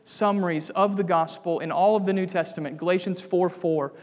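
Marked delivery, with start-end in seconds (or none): none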